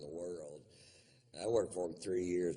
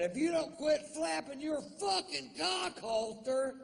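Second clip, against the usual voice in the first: much higher yell; mock shout; 4 dB louder than usual. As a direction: yell it across the theater. Shouting at the woman.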